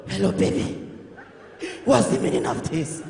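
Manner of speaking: hoarse, voiceless croak, low; voice locked up. Acted. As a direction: squeeze it out with no voice left. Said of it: "voice don't lock".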